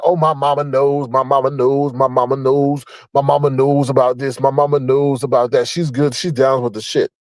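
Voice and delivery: low monotone mimicking, run together; mocking imitation. Vlogger mimicking the man about his mama, mocking drone. Mimics the man's repeated excuse.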